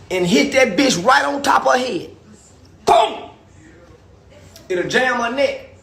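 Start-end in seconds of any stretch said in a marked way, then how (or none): 2.9-3.3 punch boom. Acted. Acting out hitting her head.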